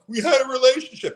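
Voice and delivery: high-pitched imitated wail; hammed-up tearful protest; mock bawling. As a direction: whine it out as if bawling. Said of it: "talking like that".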